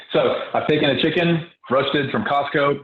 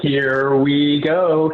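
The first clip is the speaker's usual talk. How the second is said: very slow and drawn out, a bit louder; playful dance cue; 3 semitones higher, 4 dB louder, much slower than usual. Pretend. Host answering a viewer's dance request, slow, stretched and louder. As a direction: stretch each word like a hype-up before dancing. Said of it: "I wanna see the dance moves".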